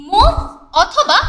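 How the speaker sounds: ordinary talk, nothing marked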